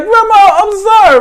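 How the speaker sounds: high-pitched imitated begging; mock pleading apology. Cousin apologising to angry grandmother, mock pleading. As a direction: plead like a kid caught out.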